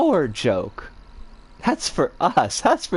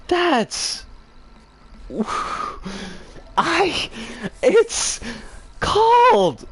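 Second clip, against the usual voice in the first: strangled outrage; 6 semitones higher, much slower than usual. Real, not acted. Furious, lost for words.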